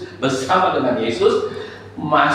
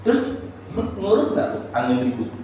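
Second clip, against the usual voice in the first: usual speaking voice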